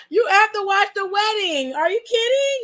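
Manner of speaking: half-laughing, half-outraged at the viewer; laughing disbelief. Real, not acted.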